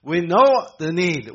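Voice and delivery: ordinary talk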